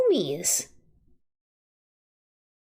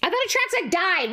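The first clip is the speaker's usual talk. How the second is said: usual speaking voice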